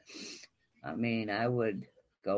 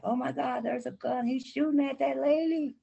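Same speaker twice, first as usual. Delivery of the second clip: imitating kids, much higher and panicky; mock-panicked children's cry; screaming kids; 13 semitones higher than usual. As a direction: mimic frightened children yelling.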